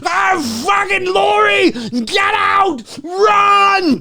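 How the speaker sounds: high, urgent shouting; hammed-up frantic shout to Laurie; mock panicked yell. Acting out the shootout scene.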